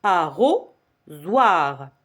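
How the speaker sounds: ARROSOIR slowly broken up, ending on a separate soir; syllable by syllable. Shown as a demonstration. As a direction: pause before "soir" in arrosoir. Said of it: "ça veut dire la troisième".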